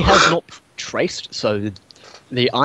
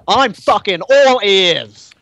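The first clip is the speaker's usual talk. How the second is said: sarcastic sneer; 5 semitones higher, 4 dB louder, much slower than usual. Acted. NPC sneers at the story.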